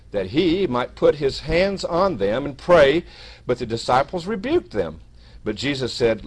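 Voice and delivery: loud, measured Bible reading; scripture reading. Acted. Preacher reads Matthew to the congregation, emphatic scripture reading. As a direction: read out the verse with weighty emphasis.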